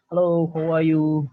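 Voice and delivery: mock cheery English hello; friendly Western greeting. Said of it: "greeting itu sangat tinggi".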